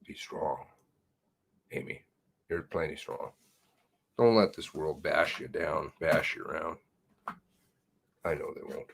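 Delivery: hushed, near-whispered comfort to Amy; quiet words of support; soft encouragement. Real, not acted. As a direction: whisper it gently, like a pep talk to a friend.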